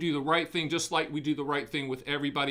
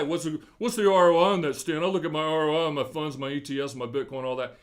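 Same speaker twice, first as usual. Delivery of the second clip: mock investor question; 2 semitones lower, 4 dB louder, faster than usual. Voicing a client asking about returns.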